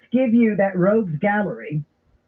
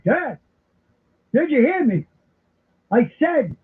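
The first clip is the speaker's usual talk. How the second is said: mock exasperated yell, pitched high; Walter Matthau yelling; 6 semitones higher, slower than usual. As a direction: imitate a comic movie grouch hollering. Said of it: "I start yelling like, like Walter Matthau".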